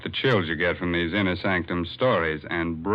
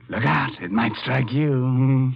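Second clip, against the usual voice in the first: spooky warning; 6 semitones higher, 3 dB louder than usual. Acted. Host spooks listener after nursery rhyme.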